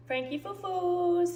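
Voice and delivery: high, slow coo of a pet name; cooing pet talk. Real, not acted. Owner cooing to the dog, high pet talk. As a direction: coo it fondly to a little dog.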